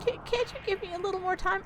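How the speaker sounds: very high, halting granny plea; stammering, put-on old-lady pleading. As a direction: beg as a high-voiced old woman. Old tenant pleads with landlord.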